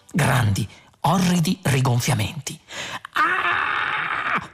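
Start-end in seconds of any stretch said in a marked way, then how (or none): 3.1-4.5 long high-pitched cry; high scream. A narrator's reading. Arthur feeling his body go limp and bend, screaming. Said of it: "fece Arthur".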